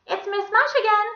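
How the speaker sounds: high, loud lady's singsong; cheery Miss Mush. A narrator's reading. Visitor calling through the classroom door, cheery high voice.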